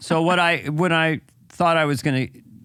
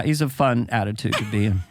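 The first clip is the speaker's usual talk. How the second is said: ordinary talk, nothing marked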